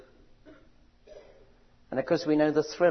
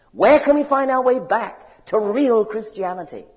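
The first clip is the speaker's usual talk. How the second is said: loud, high, impassioned preaching; preaching at full force; 8 semitones higher, 9 dB louder than usual. Acted. Preacher proclaiming scripture to the congregation, loud impassioned preaching. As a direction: thunder it out like a revival preacher.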